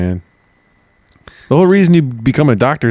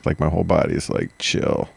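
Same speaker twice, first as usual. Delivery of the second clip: sleepy relaxed; 4 semitones lower, 8 dB quieter than usual. Pretend. Mock relaxed drift.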